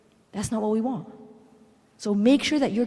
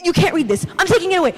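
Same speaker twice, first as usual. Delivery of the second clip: raised, louder mock outburst; freaking-out father; 7 semitones higher, 5 dB louder, much faster than usual. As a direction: play a panicking dad confiscating something. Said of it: "And he freaks out".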